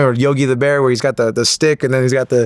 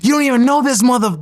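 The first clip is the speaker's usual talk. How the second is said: very high-pitched indignant outburst; imitating outraged sister; 11 semitones higher than usual. Sister objecting to brother trusting a stranger, outraged. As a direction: protest high and fast to a cut-off curse.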